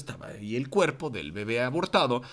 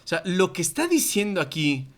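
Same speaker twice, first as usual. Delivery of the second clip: usual speaking voice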